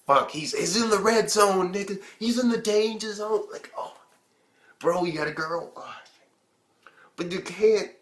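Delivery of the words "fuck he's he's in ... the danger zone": louder and higher, hyped warning; excited alarm. Retold or imitated. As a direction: sound the alarm at the screen. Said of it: "I'm like".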